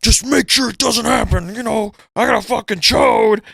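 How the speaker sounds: a hammed-up threatening Bowser; mock Bowser threat. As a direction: warn him like a jealous boss. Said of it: "He's like".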